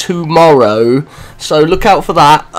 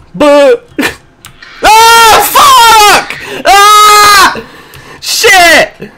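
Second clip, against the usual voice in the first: high, loud scream of real fright; startled scream; 5 dB louder, much slower than usual. Real, not acted. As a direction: shriek as something jumps out. Jump scare in game.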